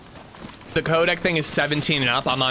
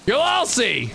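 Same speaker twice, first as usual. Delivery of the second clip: mock villain vow; 9 semitones higher, slower than usual. Mock villain vow after "you'll see.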